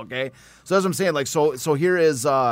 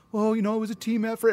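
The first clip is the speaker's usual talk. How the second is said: modest athlete; 7 semitones higher, 5 dB quieter than usual. Retold or imitated. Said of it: "he's going".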